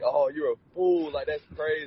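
retold scream, high but held back; imitated screaming. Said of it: "he was just screaming at the T V like".

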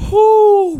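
high, loud whooping cry; whoo. Real, not acted. Reacting to reality show drama.

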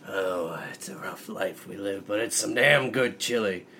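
ordinary delivery

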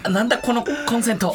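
high, fast, panicky; frantic. Acted. Repeats, now frantic.

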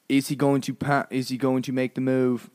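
Australian attempt that comes out British; accent slipping into British. Wildlife host awaiting the croc's move, accent drifting British. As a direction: narrate suspense in a botched British-Australian accent. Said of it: "Oh, I went British?".

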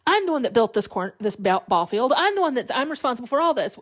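high-pitched mock indignation; upset. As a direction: protest as if wronged, pitch leaping up. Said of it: "Ray gets really upset".

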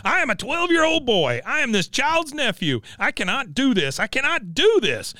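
put-on high, exasperated boy's voice; fed-up boy. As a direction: whine it like a kid at his limit. Bobby has had enough at this point.

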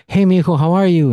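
sweet-talking cousin. Retold or imitated. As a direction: imitate the cousin cooing at his son. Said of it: "and she's".

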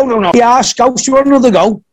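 hammed-up eager invite to go again; mock eager call. Wrestler eagerly offering another go, in a mock high, eager voice.

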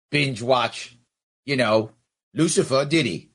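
normal talking voice

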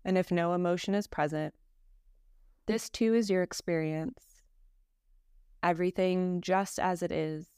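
calm, measured meditation voice with long pauses; guided meditation. Acted. Teacher leading a listener through Yoga Nidra practice, guided meditation. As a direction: guide them unhurriedly, settling each phrase into silence.

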